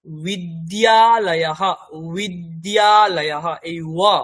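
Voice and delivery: বিদ্যালয়াহা with a w-like ব and a drawn-out -aha, very slow; soft w sound. Shown as a demonstration. Teacher showing the semivowel ব to students, very slow. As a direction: stretch ওয়া out like a glide. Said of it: "এটা আলাদা".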